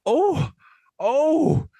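a sudden high oh, then here we go; delighted mock gasp. Real, not acted. Host drops a controversial joke, he squeals in delighted surprise. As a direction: yelp in thrilled surprise at the bombshell.

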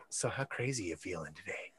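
very low, hushed mock-crazy proposition; Andral. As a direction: sidle up and murmur it deep under the breath. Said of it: "Andral being like".

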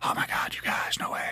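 whispered crowd awe; awed whisper. Retold or imitated. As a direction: whisper it like a starstruck fan. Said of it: "entire crowd saying in unison".

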